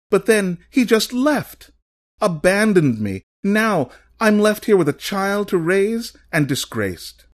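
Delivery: high-pitched aggrieved woman's voice; abandoned mother's plaintive, heightened testimony; distraught woman. A narrator's reading. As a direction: pour out the grievance, high and pained. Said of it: "A woman standing behind him then said".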